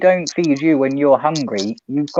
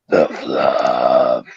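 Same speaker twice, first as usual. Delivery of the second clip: witch voice. Pretend. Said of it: "my well witch voice".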